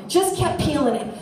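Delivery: ordinary delivery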